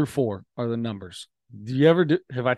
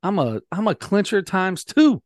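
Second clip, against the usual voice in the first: incredulous; 6 semitones higher than usual. Retold or imitated. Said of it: "I was like".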